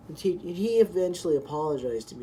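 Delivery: ordinary delivery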